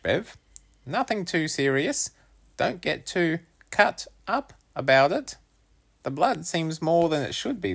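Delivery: put-on boy voice. Wolfie reassures, voiced high.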